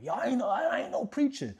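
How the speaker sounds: high-pitched indignant denial; acted-out huffy objection, pitch way up; mock mad. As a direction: protest the nickname in comic annoyance. Retells being mad at nickname.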